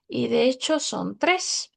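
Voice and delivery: usual speaking voice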